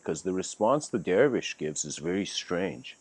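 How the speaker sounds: usual speaking voice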